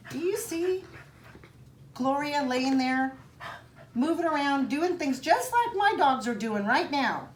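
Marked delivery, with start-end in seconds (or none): none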